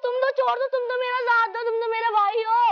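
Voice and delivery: high-pitched, urgent appeal; desperate pleading. Acted. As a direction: beg for help. Girl begs her brother for help.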